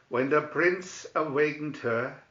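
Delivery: ordinary delivery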